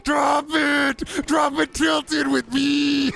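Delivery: high, slow, yelling gamer voice; a frantic streamer yelling high-pitched callouts; Connor O'Malley impression. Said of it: "I'm thinking about Connor O'Malley doing a Fortnite Let's Play".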